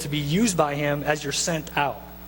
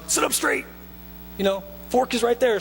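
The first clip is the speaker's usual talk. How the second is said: much higher prim parent voice; proper parent; 5 semitones higher than usual. Retold or imitated. Parent at a proper dinner table correcting the kids, prim and much higher. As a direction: scold like a fussy mom at dinner.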